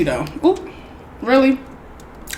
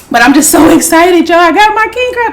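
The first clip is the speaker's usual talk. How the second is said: higher, louder, thrilled burst; rising burst of excitement over the crab; real excitement; 6 semitones higher, 4 dB louder, faster than usual. Real, not acted.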